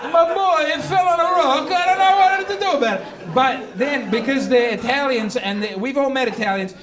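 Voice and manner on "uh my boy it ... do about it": higher, hammed-up Italian excuse; a father feigning innocence in Italian accent; mock Italian parent. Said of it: "they're going like".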